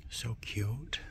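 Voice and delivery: charmed whisper. Real, not acted. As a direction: breathe it out at the tiny lizard. Whispered over lizard.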